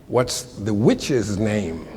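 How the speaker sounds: very slow, deliberate delivery; retold veiled insult. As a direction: draw out each word for comic effect. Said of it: "That's what it sounded like".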